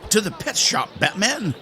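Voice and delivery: high, excited battle cry; rally the sidekick in mock-heroic style; mock superhero cry. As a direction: call it out high like a cartoon hero. Echoes game line as hero cry.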